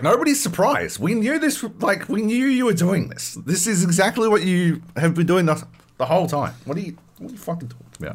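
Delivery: mock exasperated Norwegians; exasperated retort. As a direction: scoff at the obvious.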